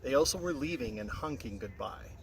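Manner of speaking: usual speaking voice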